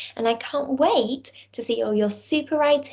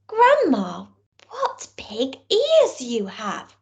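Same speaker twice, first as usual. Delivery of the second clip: put-on amazed little girl; 2 semitones higher, slower than usual. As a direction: draw it out like a wide-eyed child. Girl marvels at grandma.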